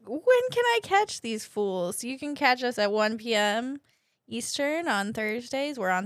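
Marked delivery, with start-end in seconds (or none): 0.1-2.0 lightly acted listener question, pitched up and drawn out; slow, higher mock question; wondering listener. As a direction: put on a curious fan asking. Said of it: "if you're wondering".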